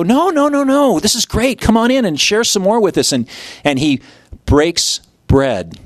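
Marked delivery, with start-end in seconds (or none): 0.0-3.1 much higher, urgent invitation; eager pleading. Retold or imitated. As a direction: beg him to stay, all excited. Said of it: "And they go".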